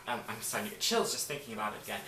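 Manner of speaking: ordinary delivery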